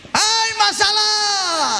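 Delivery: slow, high shouted call. Shown as a demonstration. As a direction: yell at the problem by the sea. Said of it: "Teriak, saya teriak". (teriakan tinggi)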